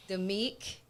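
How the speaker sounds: ordinary talk, nothing marked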